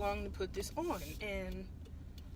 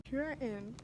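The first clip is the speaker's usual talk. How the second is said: ordinary talk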